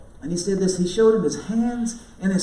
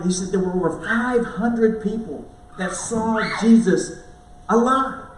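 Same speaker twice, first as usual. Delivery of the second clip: normal talking voice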